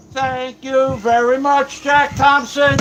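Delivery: drawn-out, high, sing-song thank you; mock high thanks. Streamer thanking Jack Thompson for the tip, high and drawn out. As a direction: stretch it out high like a showman.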